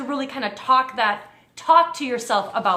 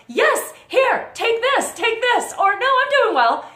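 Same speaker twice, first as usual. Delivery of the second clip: high, louder, eager outburst; eager acceptance; 10 semitones higher, 4 dB louder than usual. Acted. Speaker acts out her own reply to an offer of help, eagerly. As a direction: jump at the offer, pitched way up.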